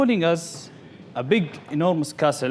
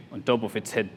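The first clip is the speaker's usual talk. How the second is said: ordinary talk, nothing marked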